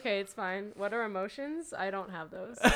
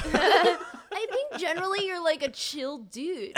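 slow, higher mock cool-guy voice; a lightly voiced laid-back dude; playful bro voice; put-on voice; 7 semitones higher, 4 dB louder, slower than usual. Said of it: "I like that voice".

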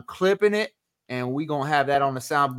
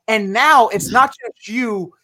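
ordinary delivery

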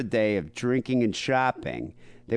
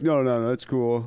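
relaxed bartender; slower than usual. Retold or imitated. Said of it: "he'd always be like".

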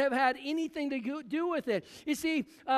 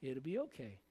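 much lower and much softer, soothing; calm, a bit patronizing; 10 semitones lower, 10 dB quieter than usual. Acted. Preacher voicing the Lord answering anxious Martha, soft and patronizing. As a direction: hush her gently like a fretting child. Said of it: "Patting her on the head".

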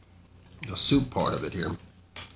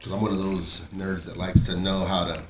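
ordinary talk

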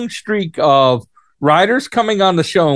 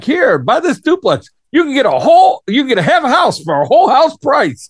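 higher, hammed-up sales pitch voice; real estate commercial; 8 semitones higher than usual. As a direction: pitch it like a cheesy ad. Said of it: "I could just love writing the real estate commercial for that".

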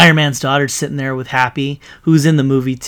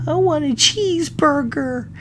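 put-on high, drawn-out little girl voice; slow, high-pitched imitation of a child; 13 semitones higher than usual. Iron Man's daughter asking for food, in a high little girl voice. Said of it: "And the little girl goes".

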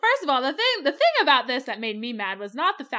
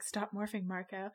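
hushed urgent hiss; 3 semitones lower, 10 dB quieter than usual. Retold or imitated. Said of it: "and Jake's like".

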